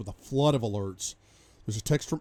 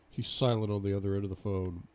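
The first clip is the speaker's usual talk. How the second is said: ordinary delivery, nothing marked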